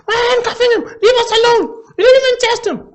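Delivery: high, loud, frantic falsetto; hammed-up high panicked girl voice; mock pleading sister. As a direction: plead like a tipsy girl at the cops. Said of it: "I was like".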